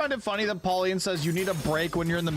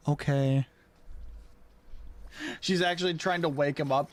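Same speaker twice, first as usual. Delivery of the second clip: usual speaking voice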